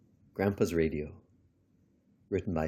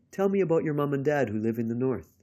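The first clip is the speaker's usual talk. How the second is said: put-on higher, louder child's request; eager grandchild; 7 semitones higher, 5 dB louder, faster than usual. A narrator's reading. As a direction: pipe up as a grandchild wanting a story. Grandchild asks Grandpa for his story.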